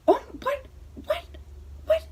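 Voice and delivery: imitating stunned, very high-pitched disbelief; mock gasped questions, pitched right up; shocked grandma. Grandma seeing the tattoo, shocked. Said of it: "she was just so shocked".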